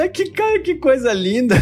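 high, gushing mock rapture; gushing delight. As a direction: swoon over the friendly attendant. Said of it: "Eu falei". (encantamento exagerado)

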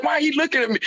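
high, baffled question; light mock confusion; mock puzzled. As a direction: pitch it up, confused. Retold confusion at friend's look.

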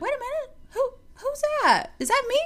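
high-pitched, surprised squeal to yourself; very high mock astonishment; mirror double take.

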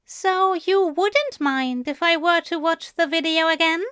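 bashful. A narrator's reading. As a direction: ask it shyly, lightly voiced angel. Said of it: "Aziraphale asked bashfully".

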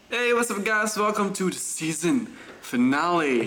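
high, slow, showy show opener; host intro. Acted. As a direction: welcome the audience like a presenter. Podcast host opening the finale.

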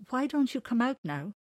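a hint of an eager child; lightly voiced child. JC invites the big man out, lightly voiced child.

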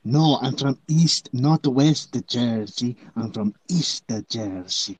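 mock East Jersey accent. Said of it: "This is the East Jersey accent".

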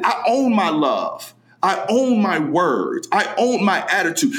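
"own" slow and weighted each line; stress on own. Real, not acted. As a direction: lean on "own" every time. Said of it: "slow emphatic list".

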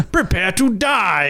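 high, slow, hammed-up villain; space invader threat. Invader walking into Baskin Robbins, slow mock-villain threat. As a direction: stretch out the grand threat. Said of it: "and he's like".

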